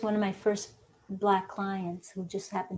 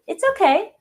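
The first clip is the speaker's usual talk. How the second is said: much higher, bright mock-cheerful reassurance; reassuring; 5 semitones higher, 5 dB louder than usual. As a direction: soothe the embarrassed client, pitched up. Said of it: "And I said".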